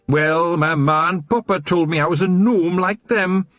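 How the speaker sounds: high Scots voice, gloating; a wee copper who caught them red-handed; smug Scottish watchman. A narrator's reading. Wee Mad Arthur to the Feagles, gloating Scots voice.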